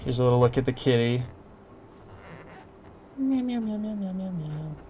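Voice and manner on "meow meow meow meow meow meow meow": high squeaky meowing at the cat; cat meows. Pretend. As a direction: meow like a kitten, fading out. Showing the kitty on stream.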